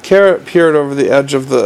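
usual speaking voice

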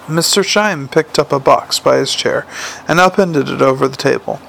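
usual speaking voice

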